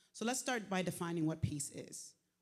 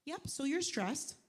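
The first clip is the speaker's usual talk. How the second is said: mock offhand doctor; 7 semitones higher, 6 dB louder, slower than usual. Doctors' casual diagnosis, retold.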